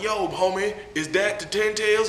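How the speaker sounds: high-pitched mock homie. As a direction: call it out high, like a startled homie. Voices Killer Bee's homies.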